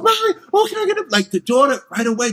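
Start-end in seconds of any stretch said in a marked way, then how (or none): 0.0-1.1 put-on much higher, loud kid voice; excited little girl. Daughter begging her mother, high and loud. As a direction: squeal it like an eager child. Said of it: "she's going".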